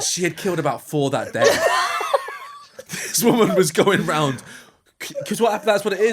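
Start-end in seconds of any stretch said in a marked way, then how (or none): 0.0-4.4 laughing. Real, not acted. Still laughing.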